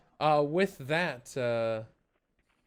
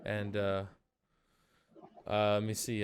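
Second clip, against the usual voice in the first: ordinary talk, nothing marked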